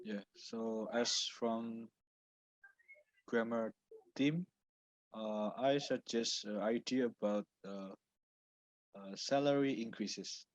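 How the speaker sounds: very soft, low-volume speech; barely audible. Real, not acted. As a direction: speak barely above a murmur. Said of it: "Your voice is very low".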